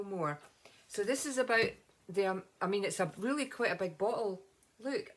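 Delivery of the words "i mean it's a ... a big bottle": normal talking voice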